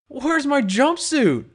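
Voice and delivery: startled. Acted. Pony waking naked asks the bartender, startled. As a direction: suddenly realise you're naked. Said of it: "I asked, startled".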